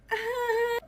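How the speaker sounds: high-pitched whimpering laugh; mock nervous laugh, drawn out. Woman reacting to a veiled threat, high nervous laugh.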